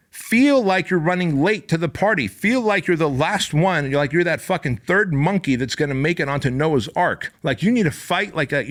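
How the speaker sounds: usual speaking voice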